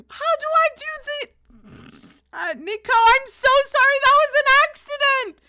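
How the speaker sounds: high, fast, frantic pleading; real panic, apologizing; frantic apology. Real, not acted. Panicking over the accidental time-out.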